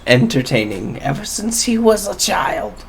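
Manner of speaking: a hint of a chuckling old woman; lightly voiced old lady, amused. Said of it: "She laughs and she says".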